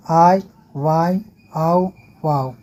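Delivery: slow, stretched आई, वाई, आओ, वाओ; teacher slowly reciting the suffixes आई, वाई, आओ, वाओ; Khortha endings drawn out. Shown as a demonstration. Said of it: "करण कारक में".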